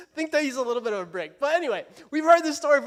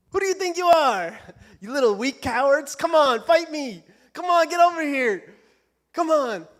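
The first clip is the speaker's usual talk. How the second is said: loud, raised-pitch challenge; taunting yell; 5 semitones higher, 6 dB louder than usual. Retold or imitated. Preacher as giant taunting Israelite army, mock yelling. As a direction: yell it like a giant daring cowards to fight. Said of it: "yelling at these little Israelites".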